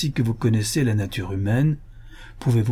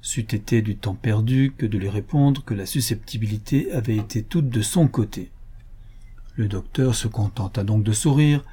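ordinary delivery, nothing marked

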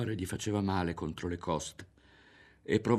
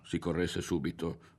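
ordinary delivery

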